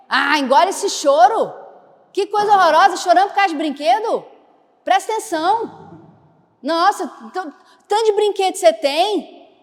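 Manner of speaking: put-on loud, harsh scolding of a crying boy; scolding parent. A disapproving parent scolds his crying son, loud. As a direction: tell the child off sharply for crying. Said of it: "O pai desaprovador".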